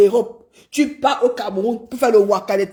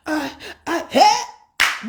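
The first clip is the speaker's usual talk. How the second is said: hammed-up moans of a woman; a mocking imitation of moaning; mock moaning cries; 3 semitones higher, much slower than usual. Mimics sex cries.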